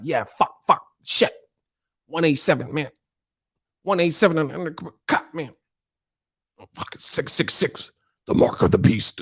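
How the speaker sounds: muted, broken-up mock cursing; mock mad. Streamer imitating angry fans who come at him, mock mad muttering. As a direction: imitate a seething fan spitting stray curses. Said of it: "like, mad and shit".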